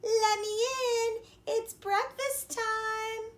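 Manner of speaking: high, slow put-on girly voice; wolf faking girl voice. A narrator's reading. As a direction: pretend to be the little girl.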